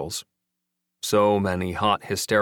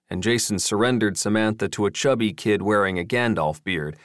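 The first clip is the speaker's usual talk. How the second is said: usual speaking voice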